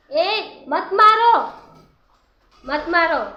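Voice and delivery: high, loud call to stop; real sharp rise in pitch and volume, calling out; urgent shout. Real, not acted. Vlogger stopping someone hitting the bird, urgent shout.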